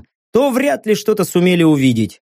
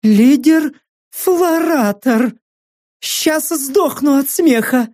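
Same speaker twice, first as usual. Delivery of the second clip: mocking mercenary woman; 10 semitones higher, slower than usual.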